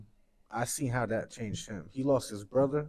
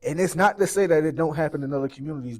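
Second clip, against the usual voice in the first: louder, higher, pleading rant; passionate rant; 4 semitones higher, 6 dB louder than usual. Real, not acted. Mourning friend rants to host about the community, louder and higher. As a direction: let the frustration pour out. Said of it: "and this is a little rant".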